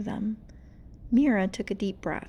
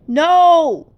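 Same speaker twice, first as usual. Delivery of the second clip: very loud, high shriek; 8 semitones higher, 17 dB louder than usual. Acted. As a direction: scream it out in agony. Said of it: "she shrieked".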